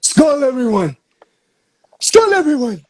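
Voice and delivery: boisterous Viking toast; loud toast. Shown as a demonstration. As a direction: shout the toast. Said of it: "We forgot to say skull".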